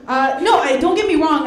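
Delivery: ordinary talk, nothing marked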